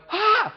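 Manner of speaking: sharp high mock gasp of alarm; alarmed parent gasp. Parent shrieking at a fallen toddler, sharp high gasp. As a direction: gasp in panic at the fall.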